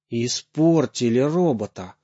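plain reading voice

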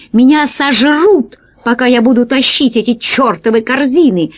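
ordinary delivery, nothing marked

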